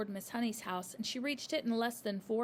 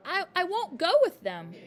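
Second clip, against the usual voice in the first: high loud refusal; defiant child; 9 semitones higher, 10 dB louder than usual. A narrator's reading. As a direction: stamp down her refusal. Matilda still shouting.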